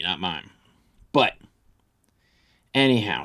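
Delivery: ordinary talk, nothing marked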